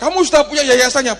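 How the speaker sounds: high, loud, fast official question; a hint of a pushy official; mock official. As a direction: bark it like a suspicious bureaucrat.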